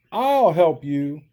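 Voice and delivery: loud, high call to the tiny voice; earnest elephant calling out. Acted. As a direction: call out eagerly to help. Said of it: "said Horton".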